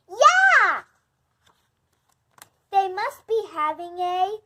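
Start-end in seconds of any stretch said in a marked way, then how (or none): 0.1-0.8 a big, loud, happy yeah; loud cheer. Acted. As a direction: shout it out happily. Snake voice cheering loudly.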